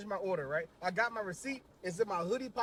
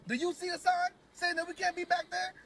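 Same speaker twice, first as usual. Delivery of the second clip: put-on higher, pushy question from an old man; indignant old man; 7 semitones higher than usual. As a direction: demand backup from a stranger. Old man demanding of narrator.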